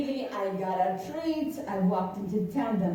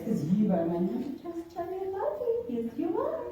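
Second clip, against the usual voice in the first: pet talk; 7 semitones higher than usual. Real, not acted. Cooing to the puppy.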